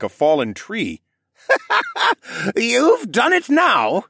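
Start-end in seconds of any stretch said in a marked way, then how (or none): 1.5-2.5 put-on high wheezing old man's cackle; drunken cackle. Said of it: "Jenner cackled". 2.6-4.0 high, slow, cackling taunt. Acted. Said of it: "Jenner cackled".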